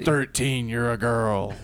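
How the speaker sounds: lightly grumbled doctor impression; a hint of surly TV doctor; House. Addressing colleague Thirteen, lightly grumbled doctor impression. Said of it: "this is my perfect house impression".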